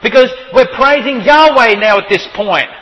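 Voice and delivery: loud, high fervent preaching; preaching at full force. Acted. Preacher hammering his point to the congregation, fervent. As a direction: drive the point home to the pews.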